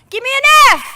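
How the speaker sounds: high, loud cheer call; cheerleader shout. Acted. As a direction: yell it like a cheerleader. Leading a cheer chant.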